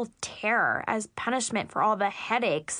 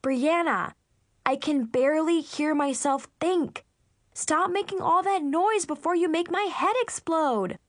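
higher-pitched, mildly raised yell; exasperated yell; 6 semitones higher than usual. Acted. Older sister stopping little sister's noise, yelling. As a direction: build it into an exasperated holler. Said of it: "I yelled".

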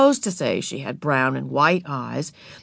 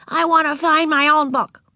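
put-on little girl voice; 10 semitones higher, 5 dB louder than usual. A narrator's reading. Narrator voicing Ramona to Beezus, high and childlike. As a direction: play four-year-old Ramona, proud.

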